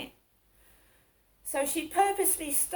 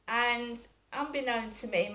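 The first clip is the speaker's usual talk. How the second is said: ordinary talk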